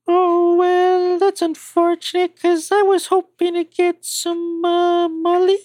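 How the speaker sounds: put-on coy girlish ask; young woman voice. Liz asks for drugs.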